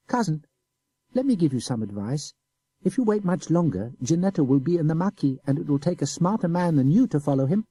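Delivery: put-on high, innocent boy's voice; simple child. A narrator's reading. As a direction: play the guileless little boy. Boy gives advice.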